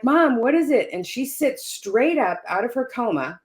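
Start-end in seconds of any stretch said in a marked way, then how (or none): 0.0-0.9 urgent question. Retold or imitated. Rushing to mother's side.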